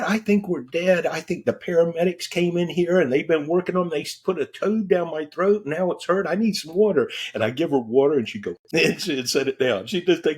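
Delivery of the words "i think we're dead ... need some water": higher mimic of his scared wife; wife panicking. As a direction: play her certain they've died, pleading. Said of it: "She said".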